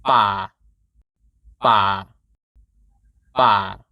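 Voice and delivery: BA on a low, steady pitch. Shown as a demonstration. Teacher modelling Thai low tone for learners, low steady tone. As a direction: drop "Ba" low and keep it steady. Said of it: "My A is low, steady pitch".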